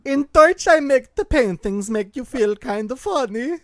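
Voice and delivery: loud, high put-on Dutch accent; playful hammed-up Dutch accent. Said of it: "That was good".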